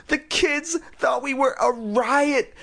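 put-on high, slow bragging girl. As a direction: brag in a high kid voice. Lindy bragging.

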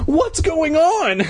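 very high, slow, scared squeak; frightened squeak. Acted. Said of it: "trembling with fright".

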